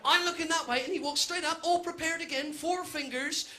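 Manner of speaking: ordinary delivery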